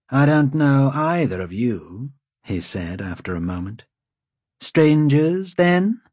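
proud, mocking lord; haughty lord. A narrator's reading. Lord Wold questions.

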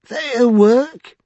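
very high, loud, incredulous scoff. A narrator's reading. Vinculus answering Childermas, scoffing in a high voice. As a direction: throw it back as a scornful rising question. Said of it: "scoffed Vinculus".

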